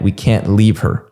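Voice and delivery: ordinary talk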